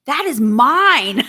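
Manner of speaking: slow, loud playful claim on mine; hammed-up possessive claim over the money; mock possessive. Joking to Mike that the money is hers, mock possessive.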